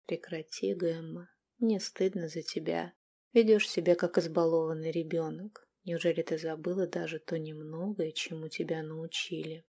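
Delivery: soft, calm scolding; quiet reproach. A narrator's reading. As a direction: shame her gently. Captive scolds queen. (тихий укор)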